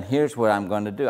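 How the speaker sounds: ordinary delivery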